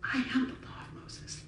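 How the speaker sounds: intense, hushed whisper; acting Jesus in a soft, confiding whisper; leaning in. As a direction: whisper it as an epic declaration. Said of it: "Jesus leans in and he goes".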